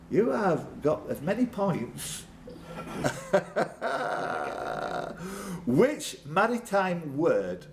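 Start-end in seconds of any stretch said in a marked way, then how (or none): 2.5-5.6 laugh. Real, not acted. Host laughs.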